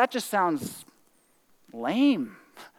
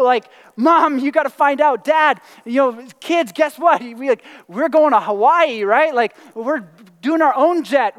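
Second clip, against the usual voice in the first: louder, higher giddy rush of news to the family; overjoyed lottery winner; 5 semitones higher, 6 dB louder than usual. Retold or imitated. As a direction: blurt it out like you just won big. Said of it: "what would your reaction be?".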